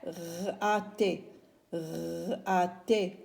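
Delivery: "R-A-T" with a drawn-out rolling R sound, then A, T. Shown as a demonstration. Teacher asking herself how it is spelt, sounding out "R-A-T". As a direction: sound "R-A-T" out piece by piece. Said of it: "comment s'écrira?".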